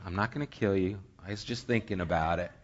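low, soft aside to the dog; calm it down quietly, deadpan; mock soothing. Trainer soothing the pinned dog.

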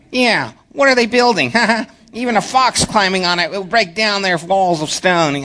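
high, giggly sidekick voice; eager breathless sidekick chatter. Acted. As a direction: play the excited little sidekick. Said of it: "his sidekick voice".

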